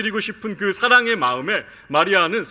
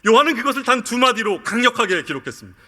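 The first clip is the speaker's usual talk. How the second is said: ordinary talk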